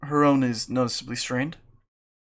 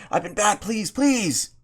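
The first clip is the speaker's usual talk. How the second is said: begging shout; 7 semitones higher, slower than usual. Real, not acted.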